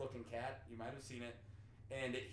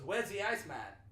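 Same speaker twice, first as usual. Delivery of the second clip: Boston question, raised pitch; imitated Boston accent; 4 semitones higher, 3 dB louder than usual. Imitating a Boston guy repeating his question, Boston accent. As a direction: drop the R's like a Bostonian. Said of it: "There was a Boston guy".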